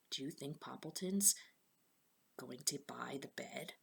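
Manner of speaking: softly asking. Real, not acted. Teacher asking the children before turning the page, hushed.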